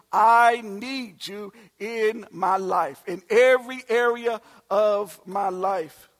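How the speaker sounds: slow, earnest praying voice; prayer. Acted. Believer praying to the Lord, slow and earnest. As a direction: pray it slowly, from the heart.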